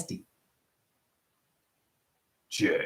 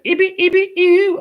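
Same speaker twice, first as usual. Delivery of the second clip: very high, louder nonsense squeal; sound check squeak; 4 dB louder than usual. Shown as a demonstration. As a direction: test the mic with a shrill squeak. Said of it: "Sound check".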